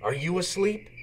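soft, hushed call in the dark; whisper-ish, trying not to wake anyone; quiet night-time peep to a sleeping engine. A narrator's reading. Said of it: "Thomas peeped".

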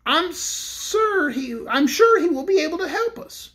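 high pleading Cook voice; Cake pleading. A narrator's reading.

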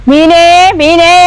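long lazy street cry; slow drawn-out call. Acted. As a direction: stretch the vendor's call out slowly. (നീട്ടി വിളിക്കുന്നു)

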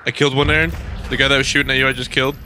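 loud excited shout to a teammate; excited callout. Real, not acted. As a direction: call it out loud over the gunfire.

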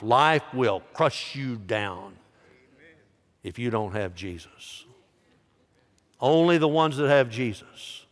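usual speaking voice

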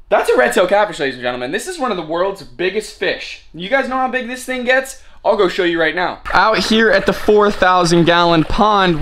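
usual speaking voice

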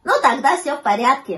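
glad, high puppy voice; delighted puppy. A narrator's reading. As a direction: cheer up as a happy little pup. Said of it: "обрадовался щенок".

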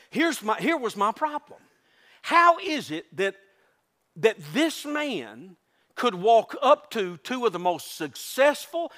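normal talking voice

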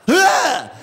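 high mock yelp of alarm; startled cry. Lady recoils from dad, mock startled cry. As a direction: imitate a spooked lady's cry.

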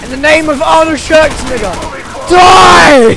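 loud, high mock war cry; battle cry. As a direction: yell it like a hero charging.

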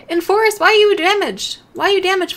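high, loud call to a pet. Real, not acted. To the stuck pet Forrest, a high loud worried call.

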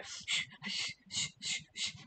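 rapid repeated "shh"; urgent shushing. Real, not acted. Streamer begging listeners to keep a secret, urgent shushing. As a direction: hush them in short unvoiced bursts.

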